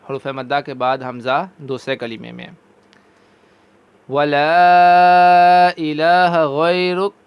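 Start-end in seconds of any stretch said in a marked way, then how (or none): 4.1-7.1 slow, drawn-out chanted recitation; slow tajweed recitation; Quranic recitation. Shown as a demonstration. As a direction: recite it slowly with a louder voice. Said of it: "ایک دفعہ پھر پڑھ لیتے ہیں".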